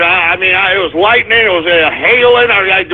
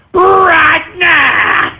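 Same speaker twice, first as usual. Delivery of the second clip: announcer shout; 8 semitones higher than usual. Acted. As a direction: pump up the segment.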